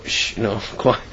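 a hushed shh with a small laugh, half whispered; quiet shushing. Shown as a demonstration. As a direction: hush it like keeping a secret. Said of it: "quietly to listen".

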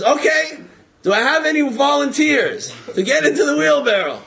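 high, loud call for volunteers; showman's challenge. Retold or imitated. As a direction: project it to a whole crowd. Stuntman asks crowd for volunteers.

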